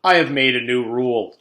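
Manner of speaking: loud, grand proclamation; declaring. Acted. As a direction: announce it with authority. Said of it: "declared Mister Kidswatter".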